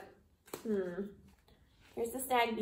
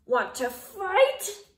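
slow, high, loud dare; slow challenge; 8 semitones higher, 7 dB louder, much slower than usual. A narrator's reading. Grouchy ladybug daring the yellow jacket, slow high dare. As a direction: drag out the dare.

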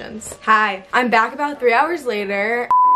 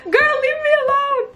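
much higher, teasing squeal; playful squeal; 3 dB louder than usual. Real, not acted. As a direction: shriek it at the commenter. Replying to a commenter.

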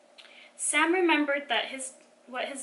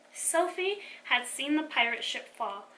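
ordinary delivery

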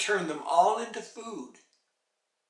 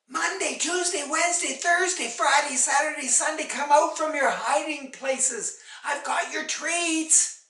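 put-on high, loud call to hidden children; raised, carrying mother's call; 10 semitones higher, 6 dB louder than usual. A narrator's reading. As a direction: holler the names in a run, coaxing them out. Mother calls hiding children out.

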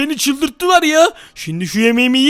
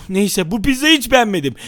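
ordinary delivery

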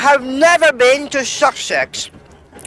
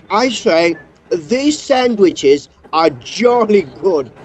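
ordinary delivery

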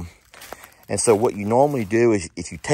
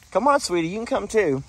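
coaxing the puppy; 10 semitones higher than usual. Real, not acted.

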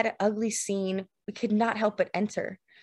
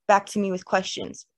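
usual speaking voice

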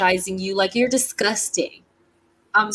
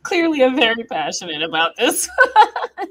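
laughing; 13 semitones higher, 4 dB louder than usual. Real, not acted. Laughing at own rant.

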